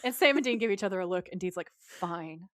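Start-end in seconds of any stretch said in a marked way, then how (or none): 1.8-2.5 low, much softer grudging "Fine.". Retold or imitated. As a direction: give in with a defeated mutter. Dean reluctantly gives in again.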